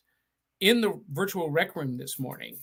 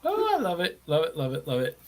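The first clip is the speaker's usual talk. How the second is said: delighted; 2 semitones lower, faster than usual. Real, not acted. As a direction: gush with delight, then trail off. Delighted reaction.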